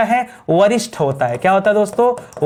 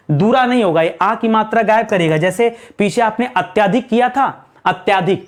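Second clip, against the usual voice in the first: ordinary talk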